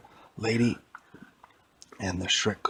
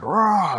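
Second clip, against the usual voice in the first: monster roar; 10 semitones higher, 9 dB louder than usual. Acted. Shrek roars at door.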